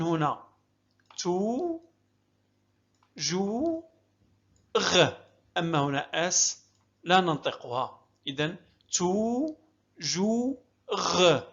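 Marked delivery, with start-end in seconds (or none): 1.2-5.1 "تو، جو، غ" as three isolated, lengthened syllables. Shown as a demonstration. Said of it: "هكذا نقسم الكلمة حتى نسهل قراءتها". 8.9-11.4 "تو، جو، غ" in slow separate beats; syllable by syllable. Shown as a demonstration. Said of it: "هكذا نقسم الكلمة حتى نسهل قراءتها".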